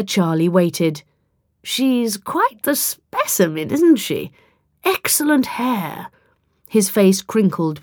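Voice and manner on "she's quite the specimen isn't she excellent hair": slow, high, appraising drawl; patronising, leisurely appraisal; condescending gentleman. A narrator's reading. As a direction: admire her like a prize specimen.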